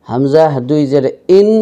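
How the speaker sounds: ইন on a clean glottal start; light vowel onset on ইন; plain hamza. Shown as a demonstration. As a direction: say ইন openly, no throat squeeze. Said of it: "হামজা উচ্চারিত".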